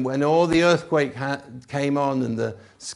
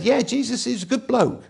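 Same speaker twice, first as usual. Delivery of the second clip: casual admirer; 7 semitones higher, 4 dB louder than usual. Retold or imitated. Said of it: "A cry that said".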